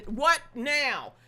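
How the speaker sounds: usual speaking voice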